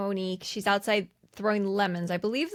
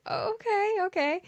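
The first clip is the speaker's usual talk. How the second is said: high okay; 11 semitones higher than usual. Retold or imitated. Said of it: "he's like".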